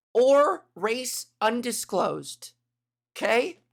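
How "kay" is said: ordinary delivery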